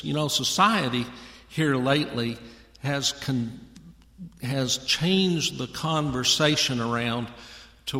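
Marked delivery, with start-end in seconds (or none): none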